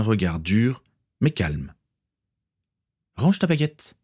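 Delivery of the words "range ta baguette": ordre ferme, voix plus aiguë. A narrator's reading. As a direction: commande sans crier. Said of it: "ordonna-t-elle". (ton de commandement féminin, contenu)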